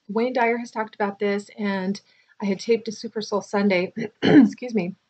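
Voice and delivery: ordinary talk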